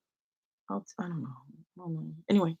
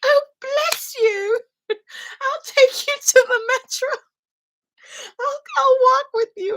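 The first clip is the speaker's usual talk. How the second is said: much higher, slow, tearful-tender lady; doting old lady; 7 dB louder, slower than usual. Retold or imitated. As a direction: coo it like a moved grandmother. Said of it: "And she was like".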